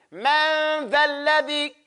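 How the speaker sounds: Man dhal ladhi drawn out slowly, mouth wide open; slow teaching demo. Shown as a demonstration. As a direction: exaggerate the mouth for learners. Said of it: "Tongue has to come out your mouth".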